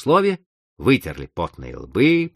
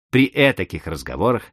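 ordinary delivery, nothing marked

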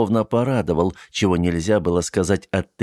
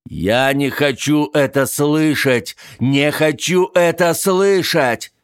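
slow, louder anguished shouting; desperate shouting; 8 semitones higher, 4 dB louder, slower than usual. Acted. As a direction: shout it over and over. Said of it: "снова и снова кричал он".

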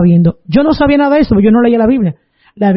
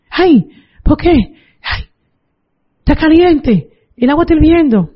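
higher-pitched cry of complaint; pained protest; 6 semitones higher than usual. Retold or imitated. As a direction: act out grandma's burning complaint without shouting. Retells grandmother's burning cries. (queja dolorida imitada, voz aguda)

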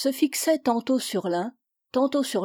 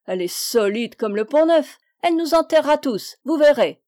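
loud, hearty pronouncement, rising higher; jovial doctor; 4 semitones higher, 6 dB louder than usual. A narrator's reading. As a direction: proclaim her good health with booming cheer. Doctor cheerfully praises old woman's health.

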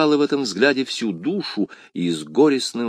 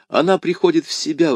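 higher, relieved cry; exclaiming; 6 semitones higher than usual. A narrator's reading. Youth sees her coming round, relieved exclamation. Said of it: "воскликнул юноша".

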